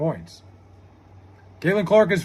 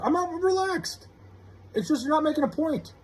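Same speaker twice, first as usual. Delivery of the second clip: high-pitched, softer complaint; exasperated protest; 8 semitones higher, 5 dB quieter than usual. Real, not acted. Streamer exasperated in heated argument.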